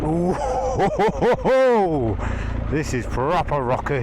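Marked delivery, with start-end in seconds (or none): 0.9-2.2 bumpy ho ho ho. Real, not acted. Bike bouncing over rocks.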